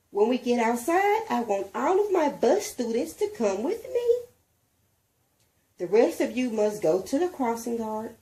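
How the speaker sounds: teacher sorting bus riders from walkers; teacher instructing. A narrator's reading. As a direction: lay out the rules for the kids.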